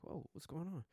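high-pitched amazed reaction; retold astonishment. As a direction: blurt it in disbelief, voice jumping up. Retelling shock at soaring numbers.

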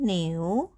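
"niu" with the pitch going up; second tone. Shown as a demonstration. Teacher showing learners the word for cow, rising second tone. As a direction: let "niu" rise to its end. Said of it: "going up".

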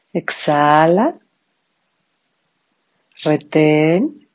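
each cue drawn out on a rise; paced breath cues. Shown as a demonstration. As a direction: lead the breathing cycle in steady time. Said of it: "nuestro pranayama".

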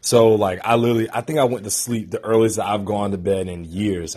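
ordinary delivery

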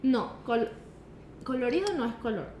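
ordinary talk, nothing marked

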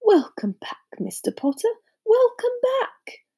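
put-on high-pitched, moved old barman; tearful welcome. A narrator's reading. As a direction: welcome them with a touch of tears. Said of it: "tears in his eyes".

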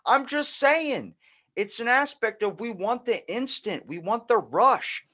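high, slow, drawn-out I-told-you-so. Retold or imitated. Gloating to his friend after the vaccine news, slow and high. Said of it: "And I was like".

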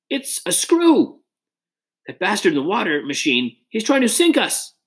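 very high, panicked cry; high-pitched alarmed exclamation; screaming in alarm. A narrator's reading. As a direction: scream it high like a man going under. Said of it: "another Tory screamed".

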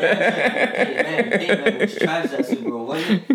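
high, loud laughing tease. Real, not acted. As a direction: cackle the words out mockingly. Teasing about Super Bowl loss.

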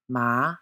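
"ma" gliding upward; second tone. Shown as a demonstration. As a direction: let "ma" rise like a question. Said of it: "a rising note".

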